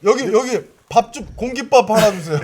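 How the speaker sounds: loud, high restaurant call. Pretend. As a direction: shout an order across the room. Calling out for rice. (식당에서 크게 부르듯)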